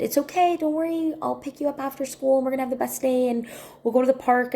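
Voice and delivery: put-on high-pitched, coaxing reassurance to a child; placating. Aunt running late to crying niece, placating.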